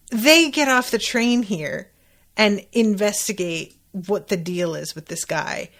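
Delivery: usual speaking voice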